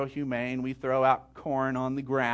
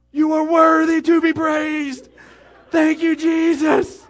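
shouted praise; 12 semitones higher, 12 dB louder than usual. Retold or imitated. Boy in the deer blind shouting praise to God. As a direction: praise shouted high and loud like church. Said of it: "next words out of his mouth".

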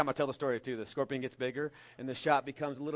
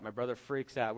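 usual speaking voice

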